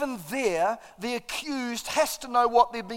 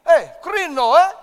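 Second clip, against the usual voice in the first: loud, higher "Hey, krino, eh?" with a tag; jokey greeting; 6 semitones higher, 12 dB louder than usual. Shown as a demonstration. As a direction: greet a neighbour cheerfully. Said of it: "You put the "eh" on the end".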